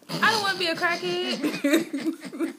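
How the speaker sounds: high, slow, laughing through it; cracking up while saying it. Real, not acted. Laughing, dramatic refusal.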